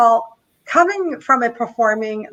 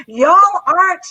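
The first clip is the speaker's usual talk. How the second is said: a hammed-up Southern "y'all aren't", pitched higher; mock Southern American accent; 6 semitones higher, 6 dB louder than usual. Shown as a demonstration. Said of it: "oh God, I can't do accents".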